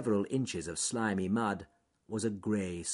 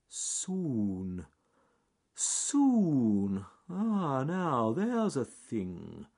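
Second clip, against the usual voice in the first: very slow drawn-out pondering; John Slow Tortoise, careful; 6 semitones higher, 4 dB louder, much slower than usual. Acted. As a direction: stretch each word, thinking it over. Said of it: "said John Slow Tortoise carefully".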